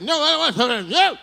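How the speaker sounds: high-pitched mock whine of refusal; high mock protest. Refusing the woman, high mock whine. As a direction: whine no like a cornered kid.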